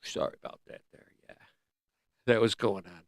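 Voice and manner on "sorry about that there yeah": fast whispered apology; quick unvoiced sorry; whispered aside. Real, not acted.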